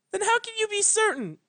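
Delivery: high, insistent pleading; desperate young pony. Acted. Twilight insisting her teacher has never tried. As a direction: push back in desperation.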